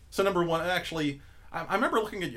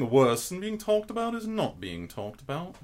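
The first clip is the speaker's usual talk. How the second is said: higher-pitched put-on British accent; mock-refined British delivery; 8 semitones higher than usual. Shown as a demonstration. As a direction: recite it in a theatrical British accent. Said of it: "do you do a British accent".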